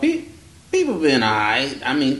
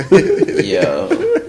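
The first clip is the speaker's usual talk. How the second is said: laughing yo; 5 dB louder than usual. Real, not acted. Laughing outburst.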